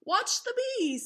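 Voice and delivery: high, eager cat voice. Acted. Cat telling the kids to watch, high and eager. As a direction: pitch it up as the excited cat.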